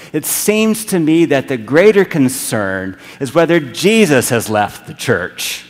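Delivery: loud, urgent sermon delivery; fiery preaching. Acted. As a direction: drive the point home from the pulpit. Preaching at full force.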